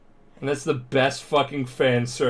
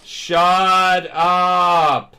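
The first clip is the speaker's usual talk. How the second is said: drawn-out, hammed-up screaming; loud, higher mock wail; Present Mike; 5 semitones higher, 4 dB louder, much slower than usual. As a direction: scream it out, stretching every word. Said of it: "he's just screaming".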